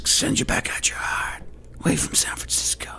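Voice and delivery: put-on creepy old-man whisper; whispered Biden ramble; mock whisper.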